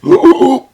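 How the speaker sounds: big, loud, high gulp of air; mock breath-holding gasp. Shown as a demonstration. Cave swimmers about to go underwater, mock gasp. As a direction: gasp hugely as if going under. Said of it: "lots of holding your breath".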